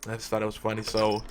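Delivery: ordinary talk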